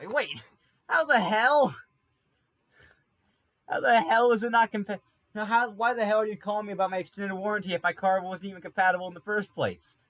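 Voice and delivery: high, loud exasperated yell; mock outrage. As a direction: rant at the warranty caller in disbelief. Outraged retort to warranty caller.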